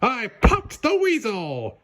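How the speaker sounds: high, slow put-on voice, proud; proud Judy. Judy telling the department she caught the weasel, high proud voice. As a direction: announce the catch proudly. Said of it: "she said".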